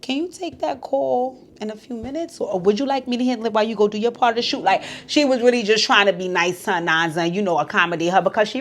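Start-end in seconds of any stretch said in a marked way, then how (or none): 0.0-4.6 gentle offer. Retold or imitated. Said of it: "She was just gonna say".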